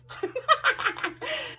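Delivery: high breathless burst of laughter. Real, not acted. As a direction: crack up, airy and high. Laughs after mock courtroom.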